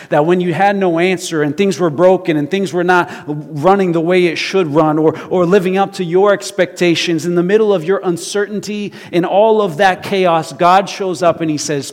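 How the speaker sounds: loud, driving preaching build-up; preaching at full force. Acted. Preacher to those who had no answer, loud impassioned preaching. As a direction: press it on the congregation loudly.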